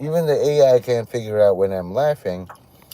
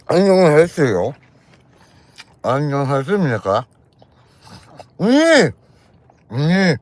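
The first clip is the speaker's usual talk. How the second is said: very high, loud, fast nasal mock Korean squeals; 7 semitones higher, 6 dB louder, much faster than usual. Performing Korean for the Korean viewers, squealed high mock Korean. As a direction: crank the pitch way up and squeal the Korean through the nose. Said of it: "So, for all the Koreans".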